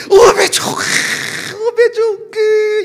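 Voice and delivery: 오베 좋은 그 drawn out high and slow in Jeolla style; Jeolla dialect exclamation. Shown as a demonstration. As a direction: savor 오베 좋은 그 slowly, high, like a Jeolla grandma. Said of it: "따라서 하세요".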